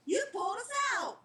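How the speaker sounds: put-on girl pleading with the reaper, high and loud; urgent reminder. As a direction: insist he saved them. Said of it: "Winifred reminded".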